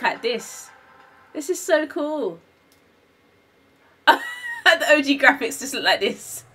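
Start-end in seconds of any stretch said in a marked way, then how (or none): 4.1-6.6 words squeezed out through laughter, slow; laughing. Real, not acted. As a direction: giggle while you talk, higher. Laughing at old graphics.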